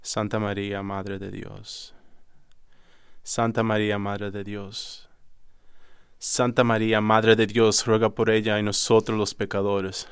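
rezo del Ave María que va de murmullo a súplica fuerte; Hail Mary prayer. Retold or imitated. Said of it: "she started praying the Hail Mary in Spanish".

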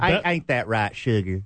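playful Deep South "sugar"; Deep South accent. Pretend. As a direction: sweet-talk like a Southern grandma. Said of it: "I really like the Deep South".

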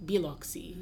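Biloxi with the stress wrongly on the first syllable, Bi; odd stress. Retold or imitated. Host imitating an actor saying Biloxi, odd first-syllable stress. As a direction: hit the BI of Biloxi, BEE-lock-see. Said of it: "a strange emphasis on the first syllabus".

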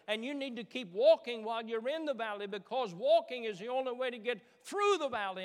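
loud, rising sermon climax; preaching at full force. Acted. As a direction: build the preaching up to a shout.

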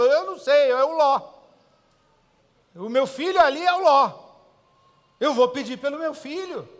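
preacher insisting he'll pray for his son; forceful declaration. Retold or imitated. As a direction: proclaim it with full fire.

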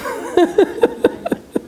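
breathy high giggle; preacher laughing at God's reply; light airy laugh. Real, not acted. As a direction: laugh at her own story.

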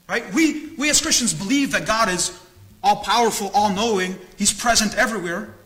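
ordinary talk, nothing marked

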